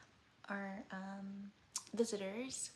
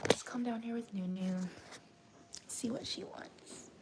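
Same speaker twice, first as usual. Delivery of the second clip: whisper; 3 dB louder than usual. Real, not acted. Approaching the baby quietly.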